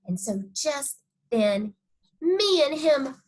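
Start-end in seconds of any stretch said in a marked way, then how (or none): none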